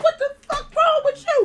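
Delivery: high-pitched, half-laughing retort; mock exasperation, voice shot way up; alarmed father. Father bursting in on yelling daughter, high mock exasperation. Startled father comes into room.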